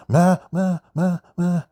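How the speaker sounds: loud, high mock cries; Kantara-style yelling imitation. Reviewer mocks a housemate charging forward like Kantara, imitated yelling. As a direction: mimic wild cries, pitched far up. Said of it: "అని కాంతారలాగా ముందుకు వస్తున్నాడు".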